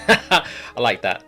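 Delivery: normal talking voice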